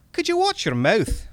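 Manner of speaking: high, loud Scottish rebuke; Scottish accent. Acted. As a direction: tick him off in a Scottish accent. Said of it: "my bad Scottish accent comes in now".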